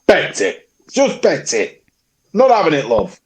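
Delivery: put-on high-pitched, indignant old Yorkshireman; old Yorkshire man. Echoing a friend's bin complaint as an old Yorkshire man. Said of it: "he's an old Yorkshire man now".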